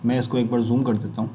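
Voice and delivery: ordinary talk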